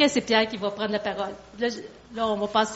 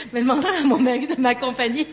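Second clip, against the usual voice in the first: quick words through real laughter; laughing; faster than usual. Real, not acted. Joking to audience about husband accompanying, laughing. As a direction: laugh while saying it. Joking before leading the song.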